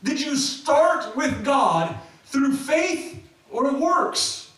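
slow, high-pitched indignant demand; all-caps outrage. Acted. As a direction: drag out each word as an exasperated accusation. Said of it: "he was all capsing".

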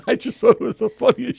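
laughing through the words; can't stop laughing as you say it. Real, not acted. Husband tells wife how funny her sleep talk was, laughing.

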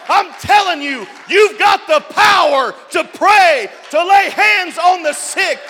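rising shouted sermon refrain; preaching at full force. Acted. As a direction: build and hammer it home like a revival preacher. Preacher exhorting congregation.

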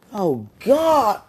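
loud, high, drawn-out exclamation; overwhelmed cry. Real, not acted. Overcome while talking to a lover, crying out. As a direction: let it burst out, high and loud. Overcome during intimate message.

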